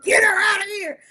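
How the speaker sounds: high, loud hollered demand; mock hollering. Recapper mimics Eli yelling to get his wife out, a laughing hammed-up holler. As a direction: holler it like a hurt man losing it. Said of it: "hooting and hollering".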